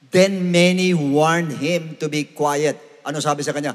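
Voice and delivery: normal talking voice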